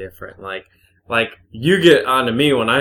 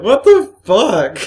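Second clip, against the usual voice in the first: high, loud, drawn-out disbelief; loud exasperated bewilderment; baffled outburst; 12 semitones higher, 9 dB louder, slower than usual. Acted. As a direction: blurt it out in stretched, incredulous shock. Acted bafflement at the simile.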